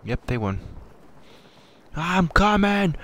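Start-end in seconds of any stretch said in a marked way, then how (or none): 1.9-2.9 high, slow drawn-out yell; shouting. Real, not acted. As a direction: call out as you charge. Rushing in to fight.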